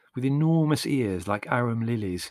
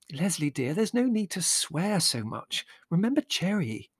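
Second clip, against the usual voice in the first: remonstrate gently in a high voice; chiding mother; 7 semitones higher than usual. A narrator's reading. Mother reproving Leslie for swearing, gently chiding.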